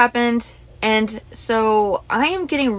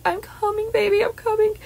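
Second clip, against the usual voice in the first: much higher, pleading call; high retold cry to a beloved pet; calling to her cat; 12 semitones higher than usual. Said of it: "I was like".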